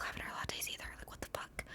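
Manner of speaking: fast hushed whisper; whispered aside. Real, not acted. Aside to viewers about the latte, a quick whisper. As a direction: whisper it like a guilty secret. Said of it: "confessing in whisper".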